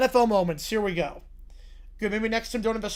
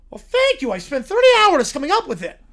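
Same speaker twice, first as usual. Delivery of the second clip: much higher, louder proud villain; squeaky villain; 6 semitones higher, 10 dB louder than usual. Acted. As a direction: preen over the silly name. Villain voice thanks mockingly.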